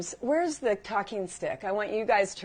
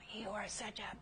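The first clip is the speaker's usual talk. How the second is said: very soft, held-back voice; hushed mock judgement; 16 dB quieter than usual. Woman acting out her written judgement of her friend, hushed. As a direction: say it almost inaudibly, far below normal volume. Voicing what they wrote down.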